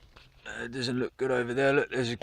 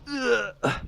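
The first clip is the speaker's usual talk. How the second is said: disgusted groan; 12 semitones higher than usual. Real, not acted. Walking through spider webs.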